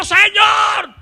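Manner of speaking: high, loud, desperate shouted plea; crying out after someone. Retold or imitated. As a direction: persistent woman yelling for mercy at full voice. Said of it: "dando voces detrás del Señor".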